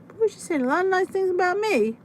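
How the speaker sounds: lightly voiced smug husband; imitating husband.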